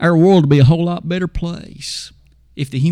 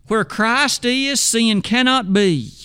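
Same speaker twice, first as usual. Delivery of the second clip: slow, weighty proclamation; 5 semitones higher, slower than usual. Real, not acted. Preaching emphasis.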